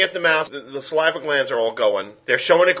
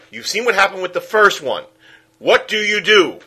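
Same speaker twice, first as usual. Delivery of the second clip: normal talking voice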